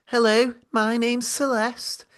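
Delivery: voice of Celeste. Acted. Imagined producer Celeste scolding the two hosts, high put-on voice. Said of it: "maybe this could be the voice of Celeste".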